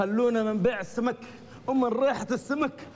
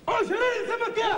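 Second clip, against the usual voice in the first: excited salesman shout; 11 semitones higher than usual. Pretend. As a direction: belt out the deal like a hawker. Climax of sales pitch.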